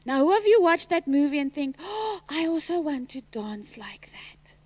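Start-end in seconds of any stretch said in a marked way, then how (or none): 1.8-4.5 whisper. Pretend. Kids' inner thought, whispered.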